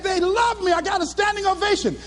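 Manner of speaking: high-pitched, elated bragging; thrilled boasting. Retold or imitated. Speaker tells girlfriend about standing ovation, thrilled. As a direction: gush proudly over the triumph.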